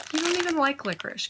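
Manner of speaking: sing-song scold to the dog; high pet-talk voice. Real, not acted. Talking to the dog.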